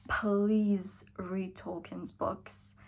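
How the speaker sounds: ordinary delivery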